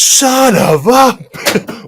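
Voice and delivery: drawn-out loud high yell; furious teacher yell. Retold or imitated. Host imitating the Key and Peele teacher, dragged-out yell. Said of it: "Key and Peele ... he goes".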